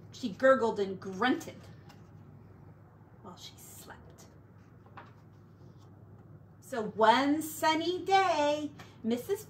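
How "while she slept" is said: hushed whisper, no voice at all. A narrator's reading. Narrator whispering so the sleeping baby stays asleep.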